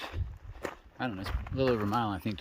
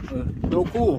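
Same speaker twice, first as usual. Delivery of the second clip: excited; 10 semitones higher, 6 dB louder than usual. Real, not acted. Thrilled to meet YouTube hikers.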